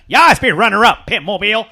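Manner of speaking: a touch of Big Al's annoying voice, pushed loud; imitating Big Al. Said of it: "his voice was annoying but good".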